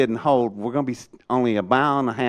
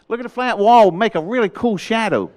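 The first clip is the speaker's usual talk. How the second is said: high, louder mock-casual quip; cheeky joke; 9 semitones higher, 5 dB louder than usual. Quipping to the colonel about shadows, playful. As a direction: deliver the punchline breezily.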